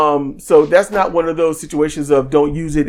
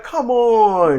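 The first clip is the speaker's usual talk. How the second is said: higher, drawn-out mock crowd groan; crowd's drawn-out protest; 6 semitones higher than usual. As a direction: imitate fans protesting without booing. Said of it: "It won't even boos".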